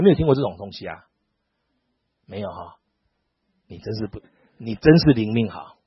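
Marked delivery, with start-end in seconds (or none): none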